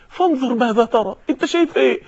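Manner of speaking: hushed, careful fatherly voice; hesitant tender father. Acted. Father cannot bring himself to say it.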